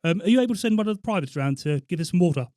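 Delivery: officer's prank-call request, projected; Colonel Tarquin. Pretend. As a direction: play the army colonel wanting a favour. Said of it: "You done spot on the voice".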